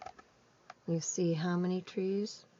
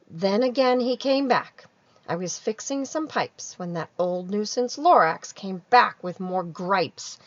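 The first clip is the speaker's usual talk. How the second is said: louder read-aloud narration; lively storytelling; 3 semitones higher, 10 dB louder than usual. A narrator's reading. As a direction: tell it with a grumble at the nuisance. Onceler narration read to kids.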